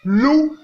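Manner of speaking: loup on a high, level, loud tone. Shown as a demonstration. Teacher giving an example word, loup on high tone. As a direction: hold loup high and even. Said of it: "ton haut".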